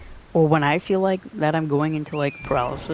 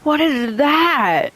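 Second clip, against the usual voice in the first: slow, high, startled "what is that"; sudden alarm at a strange sight; 11 semitones higher, 5 dB louder, much slower than usual. Real, not acted. Startled by something in game.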